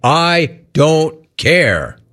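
each word drawn out very slowly; emphatic mocking quote. Radio host hammering home the quarterback's quote, slow and emphatic. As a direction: stretch I, DON'T and CARE apart, word by word. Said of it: "He said it".

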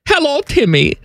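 put-on high-pitched comic German accent. Co-host showing off his storytime voice, high German accent. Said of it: "Do you like my German acc-, accent?".

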